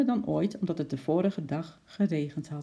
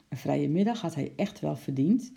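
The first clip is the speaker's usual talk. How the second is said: ordinary delivery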